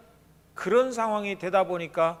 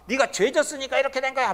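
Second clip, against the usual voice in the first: higher, accusing jab; mock accusation; 7 semitones higher than usual. Job's friends accusing him, mock scolding. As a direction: point the finger at him.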